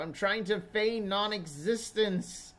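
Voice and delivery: high, loud whine of protest; irritated skeleton. Acted. Skeleton trying to sleep through intruders, loud irritated protest. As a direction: plead loudly to be left alone, drawing it out. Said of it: "he's like".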